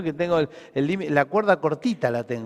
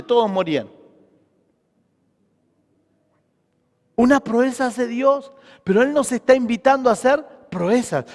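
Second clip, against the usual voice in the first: raised, emphatic sermon delivery; preaching; 5 semitones higher, 5 dB louder than usual. Real, not acted. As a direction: preach with force, leaning on key words. Preaches to congregation. (prédica enérgica y enfática)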